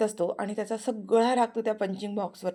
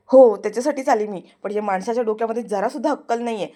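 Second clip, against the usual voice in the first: irritated snap; 8 dB louder than usual. Acted. Aarti snaps at Prithvi.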